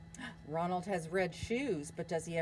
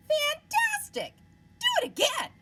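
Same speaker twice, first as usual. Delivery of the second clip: much higher and louder cheer; excited shout; 8 dB louder than usual. Acted. Princess cheering the dragon to fly again, loud and high. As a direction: egg him on with delight. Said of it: "Elizabeth shouted".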